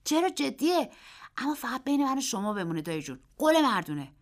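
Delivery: put-on a boy bragging about his winnings; eager boy. A narrator's reading. Narrator voices the boy. (پسربچهٔ مشتاق با صدای زیر و بلند)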